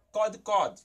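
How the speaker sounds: cod, cod said loud with an O, not an A; O sound. Shown as a demonstration. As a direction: model cod, cod with a firm O vowel. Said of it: "ela tem som de O".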